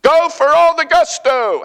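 loud, high advertising slogan; big rallying ad voice; commercial slogan. Acted. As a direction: belt it out like a hyped-up commercial. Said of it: "How many people remember that commercial?".

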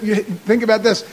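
normal talking voice

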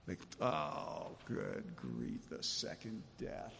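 soft scoffing aside; mock dismissive. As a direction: sneer it quietly, mocking.

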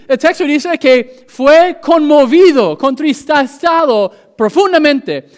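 high, loud preacher's cry; full-force preaching. Acted. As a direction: preach it out to the whole church with fire. Preaching in two languages.

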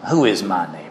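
low, mocking sneer on who; sarcastic question. Preacher voicing the smug lawyer, sarcastic. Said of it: "You can almost hear the sarcasm".

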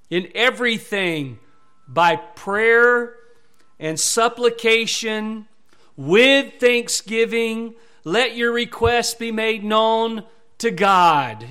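loud, slow, each phrase weighted; fervent scripture reading. Acted. Preacher proclaiming the prayer verse, loud and slow. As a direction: declare the verse to the church.

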